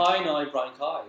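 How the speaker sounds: "Hi, now, Brown Cow" with "eye" vowels throughout; Northern Irish how now. Shown as a demonstration. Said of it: "that's how I pronounce it".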